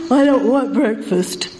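slow, sulky mock refusal; imitated sleepyhead. Speaker imitates Thomas refusing breakfast, sleepy grumble. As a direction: drag it out like a reluctant sleeper. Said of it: "He's got the covers over his head".

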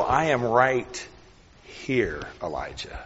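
slow, hushed and close; tender whisper. Pretend. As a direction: whisper it like comfort right beside him. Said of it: "The Lord was wanting Elijah to know".

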